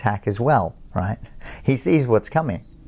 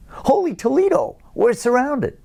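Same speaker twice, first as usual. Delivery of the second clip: put-on much higher American accent, a comic-book sidekick cry; panicked American sidekick, pitched way up; 14 semitones higher than usual. Panicking sidekick cries out to his superhero, high American accent. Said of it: "Sorry about the American accent".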